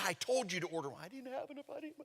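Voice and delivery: high, soft, flustered squabble; mock bickering. Retold quarrel over wine order.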